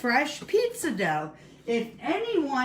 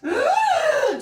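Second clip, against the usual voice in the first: much higher loud groan with a laugh; frustrated groan; 7 dB louder than usual. Pretend. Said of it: "Use noises".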